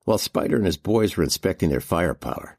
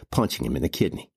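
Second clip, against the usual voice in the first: ordinary delivery, nothing marked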